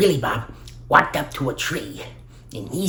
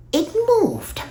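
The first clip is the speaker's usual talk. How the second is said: surprised squeak; 4 dB louder than usual. Acted.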